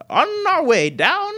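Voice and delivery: very high, loud, slow; old woman. Acted. Glenda calling the guests down, high and slow. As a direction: sing it out like a cheerful old witch. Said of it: "And she goes".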